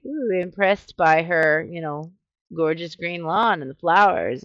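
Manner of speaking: ordinary talk, nothing marked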